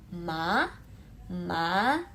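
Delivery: MA sliding upward like a surprised question; "ma" swept straight up; rising second tone. Shown as a demonstration. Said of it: "itu naik ke atas".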